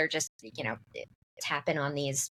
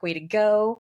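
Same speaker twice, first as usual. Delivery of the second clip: self-congratulating; 4 semitones higher, 6 dB louder than usual. Retold or imitated. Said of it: "giving myself a little pat on the back".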